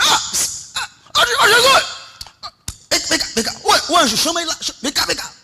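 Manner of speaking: loud, high crying out; desperate calling. Retold or imitated. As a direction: call out to Jesus with a cry. Crowd crying out to Jesus.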